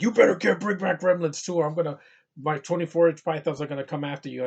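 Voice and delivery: loud wrestler's threat, starting high; Hulk Hogan. Acted. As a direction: bark a mock wrestling warning, speeding up at the end. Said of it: "cameo from Hulk Hogan".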